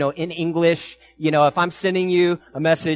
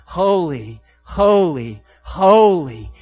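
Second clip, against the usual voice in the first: each HOLY set apart, falling, building louder; triple emphasis; 5 dB louder, much slower than usual. Acted. Preacher sounding the Trisagion of heaven, emphatic falling repetition. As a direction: say "holy" three times, each one weightier. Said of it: "three times".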